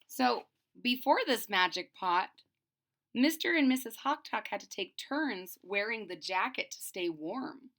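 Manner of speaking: ordinary delivery, nothing marked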